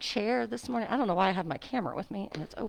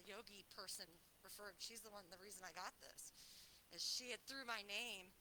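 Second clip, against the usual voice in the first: usual speaking voice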